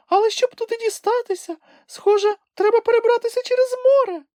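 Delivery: very high, squeaky little creature voice; a tiny animal piping up in excitement; Kulka exclaiming. A narrator's reading. Kulka tells Grampus they are almost there, squeaky excited cry.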